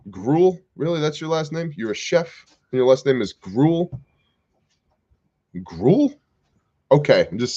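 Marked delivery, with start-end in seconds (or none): 0.1-6.1 incredulous. Real, not acted. Mocks a chef's unfortunate surname.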